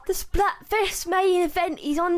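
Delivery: lightly voiced Shiver announcing; half-hearted pirate announcer. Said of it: "also the worst Shiver voice".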